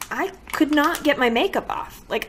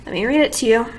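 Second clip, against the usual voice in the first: normal talking voice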